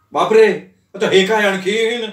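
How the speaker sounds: loud startled cry; shouting. A narrator's reading. Husband shouting in alarm at missing suit. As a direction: yell out in shock. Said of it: "तो जोरात ओरडला".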